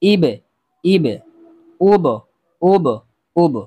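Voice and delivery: short clipped syllables ইবে and উব, each said alone; teacher drilling ইবে, ইবে, উব, উব, উব for students; recitation demo. Shown as a demonstration. Students follow the teacher's recitation of ইবে and উব, syllable by syllable. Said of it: "জের এবং পেশ দিয়ে উচ্চারণ করতে চাই তাহলে হবে ইব".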